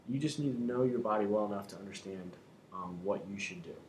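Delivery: ordinary delivery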